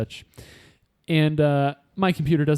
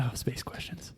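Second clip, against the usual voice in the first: whispered; 3 semitones lower, 13 dB quieter than usual. Real, not acted. Mock dread at space round.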